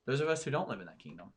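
usual speaking voice

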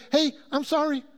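high, offhand mock apology; glib apology. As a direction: toss off a too-easy sorry. Mimics an inadequate public apology.

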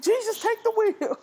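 put-on high, loud shout of an old aunt; screeching aunt watching the Super Bowl; old aunt yelling. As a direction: cry it out like a panicked grandma. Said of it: "she's like".

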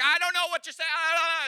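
lost for words mid-argument; flustered babble. Retold or imitated. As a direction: blurt it out as words fail you. Flustered in acted marital argument.

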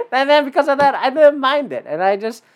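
ordinary talk

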